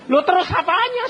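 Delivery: high, loud mock-surprised retort. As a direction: toss it back, startled and loud. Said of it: "Saya bilang". (nada kaget yang tinggi dan keras)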